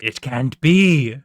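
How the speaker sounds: slow, loud attempt at a pirate voice; pirate captain. Captain sees inside the treasure box, hammed-up pirate disbelief. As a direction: ham up a stunned captain, drawn out. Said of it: "the captain of the ship is like".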